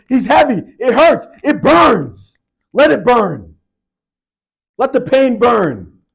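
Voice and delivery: very loud, high screaming coach. Retold or imitated. Coach screaming at his exhausted player to finish. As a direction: scream every word like the last yards.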